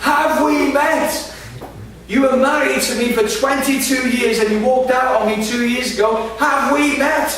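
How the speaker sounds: high, loud incredulous outrage; furious ex-wife. Retold or imitated. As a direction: throw his question back at him. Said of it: "furious woman".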